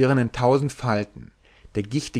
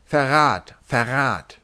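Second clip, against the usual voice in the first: calling out; 2 semitones higher, slower than usual. Acted. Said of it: "rief die Majestät vom großen Maul".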